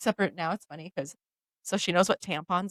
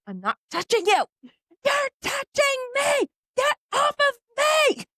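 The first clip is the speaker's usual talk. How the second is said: high mock shouting at her daughter; retold yelling; 4 dB louder, slower than usual. Mom yelling back at her toddler in bed, high mock shout. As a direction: shout it shrill like a squabbling kid.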